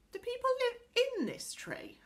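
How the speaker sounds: high-pitched question full of wonder. A narrator's reading. Little girl asks about the tree people, in wonder. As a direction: ask it in amazement, pitched high. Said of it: "asked Connie in wonder".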